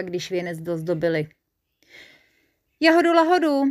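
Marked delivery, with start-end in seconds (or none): 2.8-3.6 high, loud and eager proclamation; excited character calling out a name; eager declaration. A narrator's reading. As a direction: pipe it up excitedly as a proud announcement. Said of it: "prohlásil Žilka Pilka".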